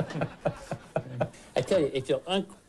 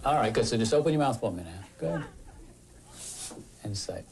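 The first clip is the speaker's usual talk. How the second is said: usual speaking voice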